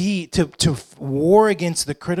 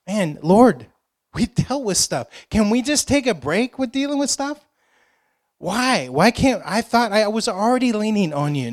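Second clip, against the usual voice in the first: high-pitched pleading protest; acted complaint; 6 semitones higher than usual. Believer asking the Lord for a break, pleading complaint.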